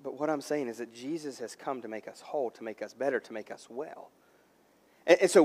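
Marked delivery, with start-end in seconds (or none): 0.0-4.1 low, very soft pastoral voice; tender, hushed reassurance. Real, not acted. Pastor telling church Jesus came to heal, softly reassuring. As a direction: drop to a gentle hush to comfort them. Pastor softens after forceful passage.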